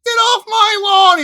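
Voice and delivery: high, full-volume codger yell; angry old man. Acted. Old Wilbur chasing visitors off his lawn, furious hammed-up shouting. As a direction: bellow at trespassers like a cranky old man.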